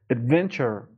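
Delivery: Adventure with firm stress on the middle syllable. Shown as a demonstration. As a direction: press on the VEN in Adventure. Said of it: "فشار رو این قسمت بذارین".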